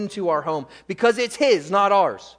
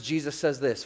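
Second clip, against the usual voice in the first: normal talking voice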